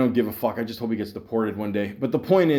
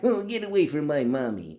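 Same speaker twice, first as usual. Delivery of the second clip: lightly mocking toddler voice; little infant; 2 semitones higher than usual. Jealous coworker as infant guarding his mommy, mock baby voice. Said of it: "Like a fucking little infant".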